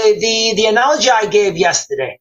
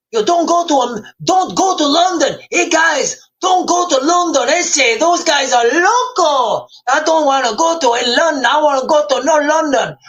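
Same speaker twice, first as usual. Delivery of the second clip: put-on Mexican accent, higher pitched; 6 semitones higher than usual. Streamer imitating Mexicans warning people off London, mock Mexican accent. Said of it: "what's a Mexican accent? I can't even do an Mexican accent".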